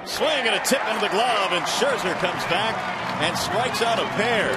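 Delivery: announcer shouting the inning-ending strikeout; excited strikeout call. Real, not acted.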